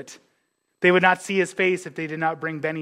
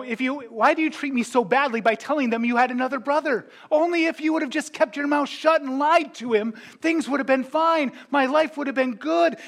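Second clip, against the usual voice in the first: put-on higher, complaining old father; Jacob's lament; 8 semitones higher than usual. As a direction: wail it like a wounded father blaming his sons. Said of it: "he tells them".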